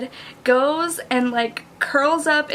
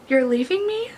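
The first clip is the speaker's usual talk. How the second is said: higher-pitched, hurt question; acted anguish, pitched up; agonized question; 6 semitones higher than usual. A narrator's reading. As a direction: rise in pained disbelief. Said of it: "agonized, breathless whisper".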